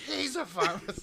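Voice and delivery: high squeaky voice. Acted. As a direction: squeak the line out high and loud. Repeats line in put-on voice.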